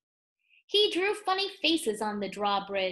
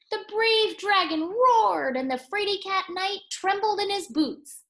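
booming voice; 9 semitones higher, 6 dB louder than usual. Acted. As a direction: roar out the story to the dragon. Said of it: "in a booming voice".

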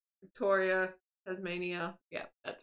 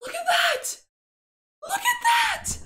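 very high, loud excited cry; thrilled squeal; 5 dB louder than usual. Real, not acted. Gamer spotting an Easter egg, real high squeal of delight.

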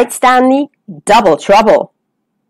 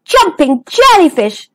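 higher-pitched startled exclamation; alarmed cry; 6 semitones higher than usual. Acted. Stanley spots the whale, alarmed cry. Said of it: "cried Stanley".

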